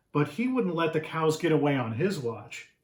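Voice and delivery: usual speaking voice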